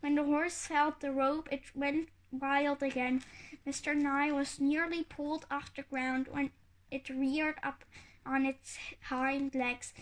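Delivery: ordinary delivery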